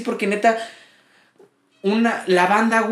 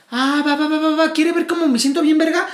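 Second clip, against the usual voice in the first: higher, fast, rowdy street taunting; mock aggressive woman; 6 semitones higher than usual. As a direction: taunt like the angry pizza customer, higher and rushed. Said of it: "la morra se pone a hablar como".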